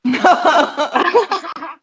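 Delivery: loud, high burst of laughter; real high, loud laugh; hearty laugh. Real, not acted. Amused by the banter.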